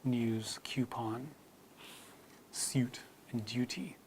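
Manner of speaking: news coupon suit duty with nyooz kyoopon syoot dyooty; British yod. Shown as a demonstration. Teacher contrasting British words for students. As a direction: add a y after the first sound of news, coupon, suit, duty. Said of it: "the British still say".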